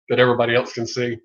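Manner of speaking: usual speaking voice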